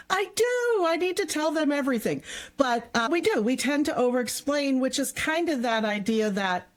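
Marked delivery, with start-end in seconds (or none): 0.1-2.2 mock insistent self. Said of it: "I'm like".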